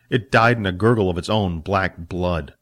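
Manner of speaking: ordinary delivery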